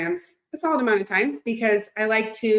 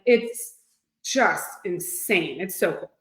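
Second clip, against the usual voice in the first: ordinary talk, nothing marked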